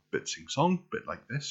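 light sing-song South Wales lilt; South Welsh accent. Shown as a demonstration. Introducing the Welsh accent, sing-song South Wales. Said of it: "South Welsh accent is a little bit".

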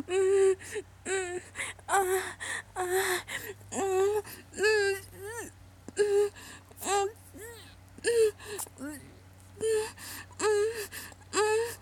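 loud muffled high whimpers; gagged moaning; muffled cries. Acted. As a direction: cry out through a full mouth.

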